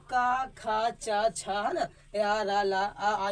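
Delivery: the teacher says का, खा, चा loud and drawn out for the class; loud drawn-out syllables. Shown as a demonstration. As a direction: call out each syllable loudly, stretched. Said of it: "र को किस प्रकार से हम लोग इसको टुकड़ा कर सकते हैं". (अक्षर ज़ोर से खींचकर बोलना)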